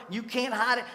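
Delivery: Paul defending himself before the king; impassioned Paul. Acted. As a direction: cry out your innocence with fire. Paul's impassioned defense in court.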